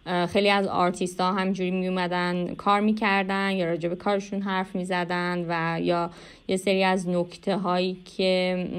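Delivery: ordinary delivery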